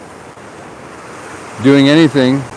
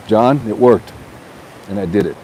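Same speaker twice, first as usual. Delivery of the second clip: usual speaking voice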